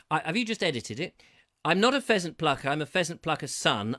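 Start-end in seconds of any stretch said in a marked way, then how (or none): 1.6-4.0 quicker, louder tongue-twister attempt; faster tongue twister. Shown as a demonstration. Host retrying the pheasant twister, faster this time. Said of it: "have to say it a bit faster than that".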